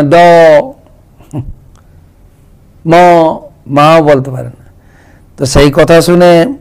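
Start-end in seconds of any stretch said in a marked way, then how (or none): 0.0-2.9 put-on a baby's clipped first sounds, da and ma; toddler babble. Shown as a demonstration. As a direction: babble like a toddler who can't finish the word. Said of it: "দাদু বলতে পারে না".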